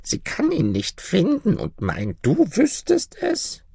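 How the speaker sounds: high-pitched little granny; coaxing old granny. Acted. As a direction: wheedle the griffin in a tiny crone voice. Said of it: "antwortete das Mütterchen".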